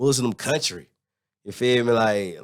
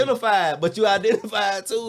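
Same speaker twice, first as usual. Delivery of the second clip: excited shout; 10 semitones higher than usual. Real, not acted. Excited punchline.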